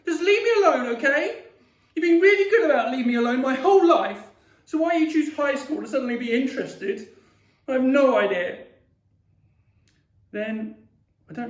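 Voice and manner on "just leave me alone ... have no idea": high-pitched, loud indignant rant; angry outburst. Acted. Child telling family to leave them alone, angrily. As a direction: tell them off at a raised pitch.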